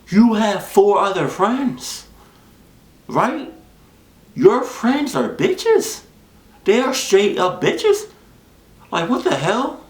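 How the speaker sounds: incredulous rant about the friends; outraged disbelief. Real, not acted.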